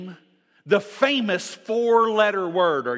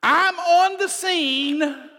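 God announcing himself; 5 semitones higher, slower than usual. Acted. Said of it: "notice what God says when he shows up".

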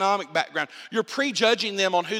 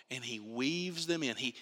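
ordinary talk

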